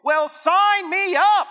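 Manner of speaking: hammed-up sarcasm, drawn out; sarcastic cheer. Sarcastic mock disciple.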